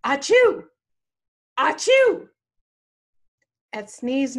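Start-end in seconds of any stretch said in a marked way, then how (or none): 0.0-0.6 high, loud acted-out sneeze; sudden loud pretend sneeze; imitated sneeze. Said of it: "a midnight solo of". 1.6-2.3 high, loud acted-out sneeze; sudden loud pretend sneeze; imitated sneeze. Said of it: "a midnight solo of".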